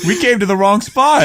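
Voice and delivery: high, puzzled character voice; imitated baffled traveler; mock confusion. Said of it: "they come back, like".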